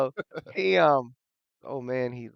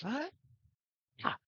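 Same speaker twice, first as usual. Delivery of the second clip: short high-pitched scream at usual volume. Acted. Old man clutching his head, sudden high scream. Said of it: "and he screams".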